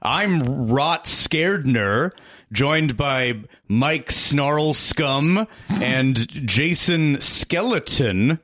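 slow, drawn-out Haunted Mansion host; mock-spooky ghost host welcome, slowed right down; ghost host parody.

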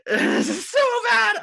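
high, loud, drawn-out wail of embarrassment; embarrassed squeal. Real, not acted. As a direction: shriek it slowly, mortified and laughing at yourself. Embarrassed outburst before confessing, second take.

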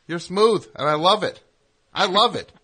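high, loud gleeful praise; loud delighted. Real, not acted. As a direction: shout your delight. Teasing praise.

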